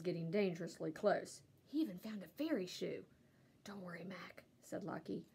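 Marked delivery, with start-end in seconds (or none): 3.6-4.4 soft reassurance. A narrator's reading. Dog reassuring quietly.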